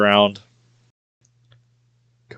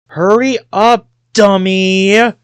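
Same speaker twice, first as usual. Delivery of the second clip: muffled shout; 9 semitones higher, 3 dB louder, slower than usual. Acted. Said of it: "I hear a muffled voice".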